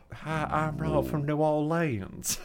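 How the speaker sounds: slow, quiet attempt at a New Orleans accent; weak New Orleans accent. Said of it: "do the accent".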